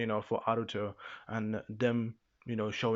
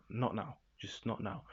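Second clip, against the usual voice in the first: much softer, lower mock plea; hushed calming down; 3 semitones lower, 10 dB quieter than usual. Yua to a very angry Fua, hushed and calming. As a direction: say it softly, under the voice.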